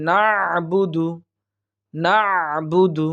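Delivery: "না'বুদু" slow, squeezed throat sound after "na"; correct throat ayn. Shown as a demonstration. Teacher modelling the throat letter, careful tajweed. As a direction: hold the constricted ayn in "না'বুদু". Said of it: "ঠিক এরকম একটা আওয়াজ আসবে".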